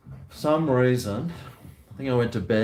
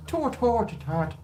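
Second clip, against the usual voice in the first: high calling sound; 13 semitones higher, slower than usual. Real, not acted. Calling out to animals.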